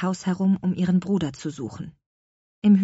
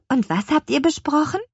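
eager; 9 semitones higher, 4 dB louder than usual. A narrator's reading. Curious girl asks.

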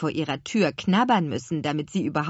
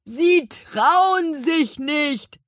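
very slow, high, loud shout; long-drawn call to someone high above; 10 semitones higher, 7 dB louder, much slower than usual. A narrator's reading. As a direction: stretch each word to carry upwards. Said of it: "rief Gurkenhut".

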